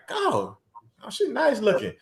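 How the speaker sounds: high, soft, admiring murmur to himself; admiring aside. Acted. Acting out admiring woman in club.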